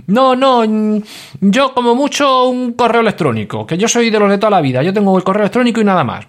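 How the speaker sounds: hammed-up old-school listener, pitched high; mock old-fashioned listener. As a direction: parody someone stuck in their ways.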